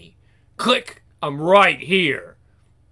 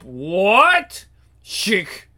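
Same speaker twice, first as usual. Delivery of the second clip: normal talking voice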